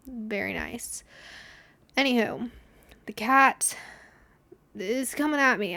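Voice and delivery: ordinary delivery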